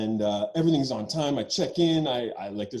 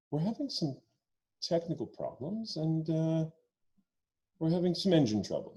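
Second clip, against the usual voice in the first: calm announcement; 5 dB quieter than usual. Retold or imitated. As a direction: imitate a pilot keeping everyone calm, quieter. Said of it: "the voice comes on very calmly".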